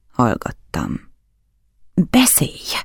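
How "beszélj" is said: high, strained cry of command; sudden outburst. A narrator's reading. Count ordering his silent guest to talk, sudden cry. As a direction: burst out abruptly, demanding an answer. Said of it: "ordította váratlan kitöréssel".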